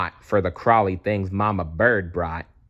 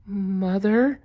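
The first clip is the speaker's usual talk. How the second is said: put-on high, tiny squeak of a lost baby bat; squeaky baby bat; 11 semitones higher than usual. A narrator's reading. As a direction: squeak out for mother, much higher. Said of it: "Stellaluna squeaked".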